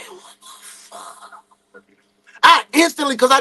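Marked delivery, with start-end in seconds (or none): 0.1-1.4 slow breathless whisper; stunned whispered curse; shocked whisper. Retold or imitated. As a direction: breathe it out in disbelief. Shocked after being beaten.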